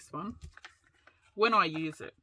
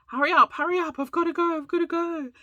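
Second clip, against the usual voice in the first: high, louder hurried mock husband; impatient husband; 10 semitones higher, 4 dB louder, faster than usual. Husband on lunch break urging her to hurry, high impatient mock voice. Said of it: "And he's like".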